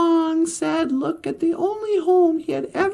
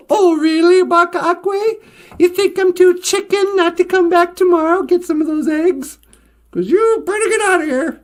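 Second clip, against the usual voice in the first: loud mock-defiant comeback; cocky taunt; 4 semitones higher, 6 dB louder than usual. Fox taunts the chicken over eggs, cocky loud comeback. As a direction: throw the threat right back, louder.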